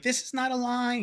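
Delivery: high, flustered imitation of the staff lady; panicking staff lady. Imitating the panicked Japanese staff lady turning people away, high-pitched. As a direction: mimic her frantic insistence. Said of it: "she's, like, panicking".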